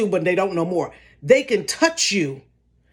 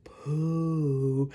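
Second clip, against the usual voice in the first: low, slow eerie moan of the name; ghostly call; 6 semitones lower, 9 dB quieter than usual. Retold or imitated. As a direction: stretch each name out like a ghost. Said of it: "They kept saying".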